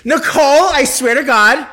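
high, loud pleading shout; exasperated shout. Real, not acted. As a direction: yell it at her in protest. Scolding friend over phone.

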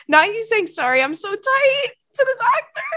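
high, loud squealing tease; shrieky incredulous recap; scandalized disbelief. Real, not acted. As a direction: repeat it in gleeful, appalled disbelief. Incredulous teasing of the emailer.

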